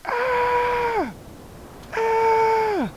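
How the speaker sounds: shrill scream of alarm; real high-pitched scream. Real, not acted. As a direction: scream as it falls. Rocket crashing.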